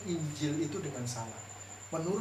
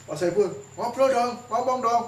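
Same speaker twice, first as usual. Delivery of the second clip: higher, louder playful nudge to talk; coaxing; 7 semitones higher, 7 dB louder than usual. Retold or imitated. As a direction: coax a sulking colleague into chatting. Membujuk orang yang cemberut bicara. (membujuk dengan suara tinggi)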